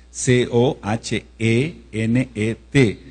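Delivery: C O H E N E T spelled letter by letter, slow; spelled out slowly. Shown as a demonstration. Teacher dictating the spelling of Cohenet, slowly. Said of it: "acento en la primera E".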